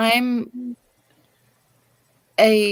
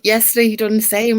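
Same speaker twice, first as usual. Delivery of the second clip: usual speaking voice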